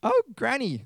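very high, falsetto-like granny voice; wrong character voice. Acted. Reader misvoices the girl's line, high granny-like voice. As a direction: slip into the squeaky granny voice by mistake. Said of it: "no, wrong voice".

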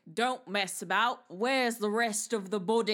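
lightly done British cop voice; British accent. Retold or imitated. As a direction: say it like a London copper. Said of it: "I wish I could do a British accent".

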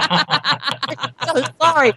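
laughing apology. Real, not acted. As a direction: apologize through helpless laughter. Cracking up after crude remark.

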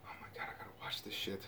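fast excited whisper. Real, not acted. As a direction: hiss it under your breath, eager. Excited aside under his breath.

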